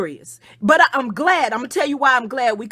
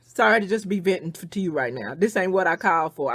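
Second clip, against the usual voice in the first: usual speaking voice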